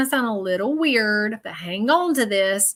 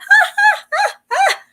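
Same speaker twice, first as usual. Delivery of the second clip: squealing high-pitched laugh; bright squealing giggle; 9 dB louder than usual. Retold or imitated. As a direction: let out a shrill little giggle like her. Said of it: "She laughs a lot".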